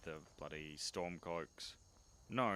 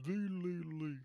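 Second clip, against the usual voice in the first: high sing-song call; 13 semitones higher, 5 dB louder, much slower than usual. Real, not acted. Calling out in game.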